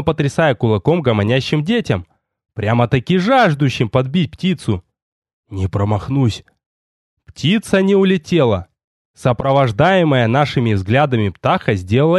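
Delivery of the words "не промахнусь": lightly voiced low hunter; low-pitched understated promise; a hint of the hunter's low voice; assuring hunter. A narrator's reading. Hunter assures his master.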